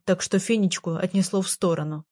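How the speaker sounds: plain reading voice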